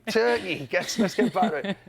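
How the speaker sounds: giggling through the words; laughing while reading. Real, not acted.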